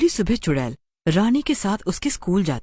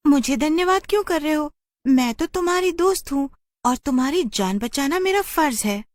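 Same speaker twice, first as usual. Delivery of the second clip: put-on higher, sweet young girl's voice with a little laugh; little girl Rani; 9 semitones higher than usual. Rani tells the rescued children she is their friend, high girlish voice. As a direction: play a modest little girl.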